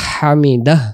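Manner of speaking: হামিদাহ ending with a breathy, audible h; clear final h. Shown as a demonstration. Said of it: "হায়ের উচ্চারণটা ভেসে উঠাইতে হবে".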